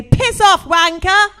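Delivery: put-on cheeky kid with a British accent; kids' British accent. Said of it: "my kids have a bit of a British accent".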